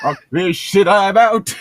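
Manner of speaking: high, loud mock outburst; mock rage quit. Pretending to quit the reading in a mock huff. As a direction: storm off in a hammed-up huff.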